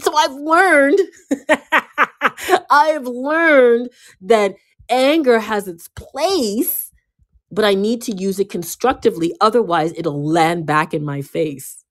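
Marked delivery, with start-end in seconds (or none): 0.0-6.9 high, slow words broken by a laugh. Real, not acted. Laughing as she tells listeners her lesson. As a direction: laugh through it, drawing it out.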